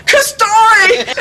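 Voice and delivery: high, loud, drawn-out call; yelled summons. Retold or imitated. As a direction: yell the name across the ashram for Kastori. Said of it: "at this he yells".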